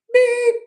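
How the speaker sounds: a high, loud electronic beep sound; imitated beep. Recalling his first answering machine, imitated beep. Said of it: "you know".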